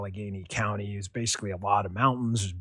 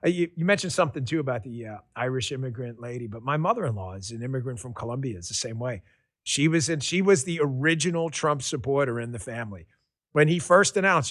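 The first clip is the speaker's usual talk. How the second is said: ordinary delivery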